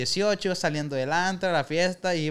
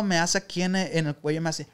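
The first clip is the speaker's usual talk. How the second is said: ordinary delivery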